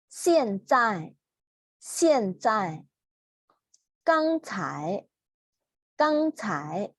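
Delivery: slow, clear Mandarin drill words said twice each for repeating; teacher's model reading. Shown as a demonstration. Teacher reads vocabulary for students to echo, slow and clear. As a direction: read each word out slowly for the class to repeat. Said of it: "ออกเสียงตามนะคะ".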